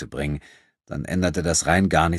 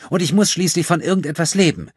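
put-on high, loud girl's protest; self-justifying plea; 12 semitones higher, 6 dB louder than usual. A narrator's reading. Thief excusing her stealing, high defensive plea. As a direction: plead your right, pitched right up.

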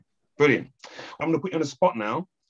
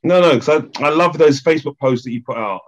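louder, bursting in with enthusiasm; eager gush; 9 dB louder than usual. Real, not acted. Host gushing to guest about her posts, loud and excited. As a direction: jump in loudly, all excited.

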